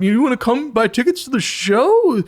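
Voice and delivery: very high, loud, needy hawker voice; begging put-on voice. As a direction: pitch it way up and plead for attention. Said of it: "I don't know why I'm doing that voice".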